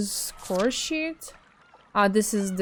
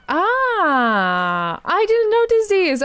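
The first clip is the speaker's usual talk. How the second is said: surprised; 10 semitones higher, 8 dB louder, much slower than usual. Real, not acted. Spots new menu options, exclaims.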